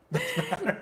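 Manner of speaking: laughing. Retold or imitated.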